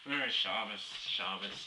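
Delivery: mock a low, grudging greeting; unwilling greeting. Shul-goer avoiding talk with passers-by, low unwilling greeting. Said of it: "like they don't wanna say anything to you".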